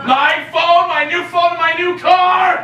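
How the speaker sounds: high, loud lament; mounting outrage over possessions; outraged wail. Acted. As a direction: shout it, drawing out the end. Outrage over the damaged car.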